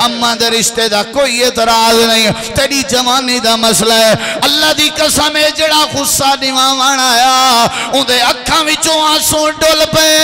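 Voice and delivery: loud preaching, swelling into a chant at the peaks; preaching at full force. Acted. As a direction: pour it out like a fired-up preacher to a crowd. Fiery sermon storytelling, loud.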